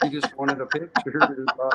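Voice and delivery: real chuckle while speaking; laughing. Real, not acted. Laughing at his own story.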